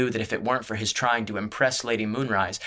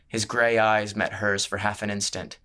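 usual speaking voice

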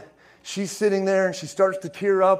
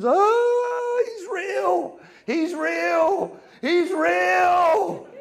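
very high, drawn-out wailing cries; imitated crying wail; 12 semitones higher, much slower than usual. Young woman crying on the ground, imitated high wail. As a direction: wail it high, imitating someone overcome and shaking. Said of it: "She's crying. She's shaking".

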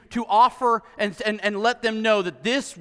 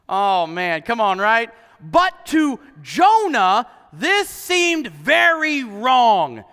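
ordinary talk, nothing marked

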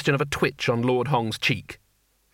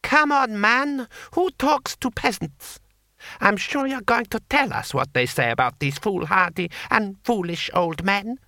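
much higher, strained lordly voice; 9 semitones higher than usual. Acted. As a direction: let the pitch climb, rattled. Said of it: "voice was slightly higher".

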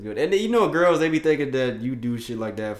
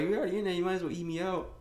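put-on high-pitched hypothetical girlfriend quote; high mock girlfriend; 10 semitones higher, 3 dB quieter, faster than usual. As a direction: pipe it up as the girl. Acting out hypothetical girlfriend's words.

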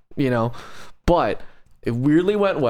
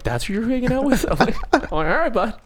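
high teasing voice; 12 semitones higher than usual. Retold or imitated. Teasing his friend Jake.

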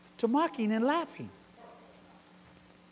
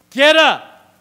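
much louder and higher, ringing; booming command; 7 semitones higher, 17 dB louder than usual. Acted. Jesus commanding the dead girl to get up, booming. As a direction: call her back from death.

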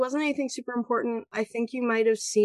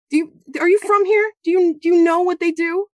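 loud, higher desperate shouting; furious yell; 8 semitones higher, 9 dB louder than usual. Acted. Goblin mother yelling at the bard, loud and furious. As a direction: rage at the stranger who doesn't get it.

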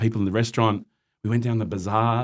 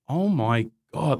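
stunned; 4 semitones higher, much slower than usual. Retold or imitated. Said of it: "And I was thinking".

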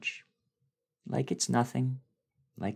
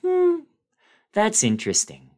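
mock uninterested public; mock indifference; 14 semitones higher, 8 dB louder than usual. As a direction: play the bored public, hmm way up high. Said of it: "And the public goes".

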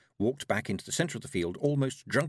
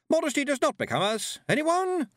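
very high, straining hostess voice; rising to a piercing, hopeful call; strained cheer; 5 dB louder than usual. A narrator's reading. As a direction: plead brightly as the strain shows. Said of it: "was getting more strained by the second".

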